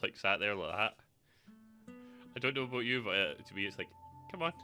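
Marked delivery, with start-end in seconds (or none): none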